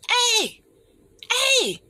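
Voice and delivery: high called-out hey; calling out. Retold or imitated. Calling a friend who ignores her, high calls.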